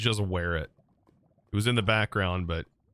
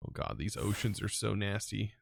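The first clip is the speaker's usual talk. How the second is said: ordinary talk, nothing marked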